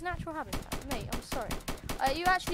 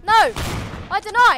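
outraged shout; 7 semitones higher, 13 dB louder than usual. Real, not acted. Refusing death in fight.

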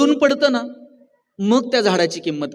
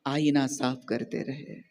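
slow, measured Urdu couplet, fading away; शेर धीमे अंदाज़ में पढ़ना; reciting a sher; 3 semitones lower, slower than usual. Shown as a demonstration. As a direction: savour the couplet slowly. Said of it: "एक शेर आहे".

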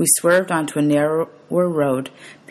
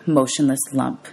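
plain reading voice

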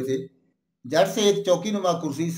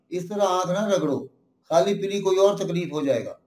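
usual speaking voice

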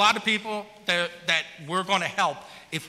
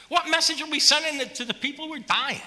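real raised voice demanding an answer; impassioned shout; 8 semitones higher than usual. Real, not acted. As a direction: let the anger burst out. Impassioned outburst at council.

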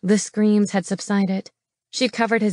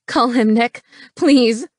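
raised, pleading cry; tearful plea; 3 semitones higher, 5 dB louder than usual. A narrator's reading. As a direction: beg urgently, lifting into a louder please. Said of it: "she cried".

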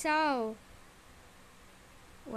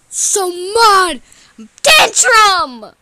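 high, loud mock outburst; tantrum; 4 semitones higher, 6 dB louder, slower than usual. Throwing a fit before onlookers, high tantrum yell. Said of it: "is she having a tantrum or something".

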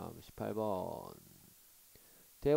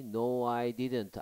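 slow clear reading; 4 dB louder, much slower than usual. Shown as a demonstration. Teacher reads dialogue slowly.

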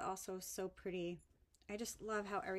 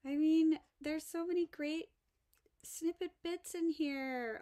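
higher, louder giddy gush; excited gushing; 6 semitones higher, 9 dB louder than usual. Real, not acted. Unboxing snippets from Roseanne, giddy and louder. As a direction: burst out with delight. Gushing over gift bits.

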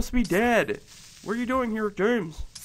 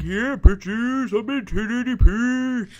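bragging; 3 semitones higher, 4 dB louder than usual. Retold or imitated. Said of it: "just for bragging rights to be like".

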